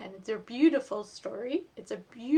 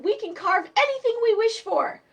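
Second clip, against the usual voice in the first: excited; 12 semitones higher, 10 dB louder than usual. Acted. Children thrilled by magic.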